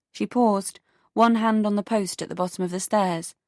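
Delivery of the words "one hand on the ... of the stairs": usual speaking voice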